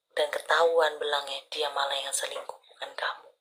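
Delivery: hushed, revealing a betrayal; whispering the cheater's secret; conspiratorial whisper. Acted. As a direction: drop to a whisper for the scandal. Reading reveals partner's cheating.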